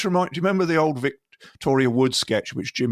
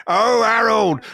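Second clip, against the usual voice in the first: put-on higher and louder, exasperated old-man wail; Steptoe impression; 6 semitones higher, 6 dB louder, much slower than usual. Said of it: "This is Steptoe".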